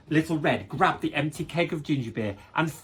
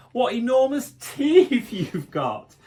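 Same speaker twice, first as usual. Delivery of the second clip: slow, high exclaim with a laugh; 8 semitones higher, 5 dB louder, slower than usual. Acted. Little Red exclaiming at the teeth, laughing. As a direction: exclaim through a giggle. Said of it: "Little Red exclaimed".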